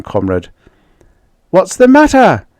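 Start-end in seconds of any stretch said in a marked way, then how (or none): 1.5-2.5 high, loud, frantic; husband on the radio, frantic and loud; frantic demand. Acted. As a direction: demand an answer in panic. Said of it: "demanded frantically".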